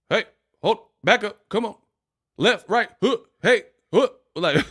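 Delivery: high barked crossing-guard calls. Retold or imitated. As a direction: shout directions like a crossing guard. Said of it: "crossing guard with Tourette's".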